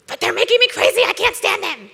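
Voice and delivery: high, loud, whining complaint; whiny body voice. Acted. The body's voice whining about the kids, high and loud. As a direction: whine like a fed-up child.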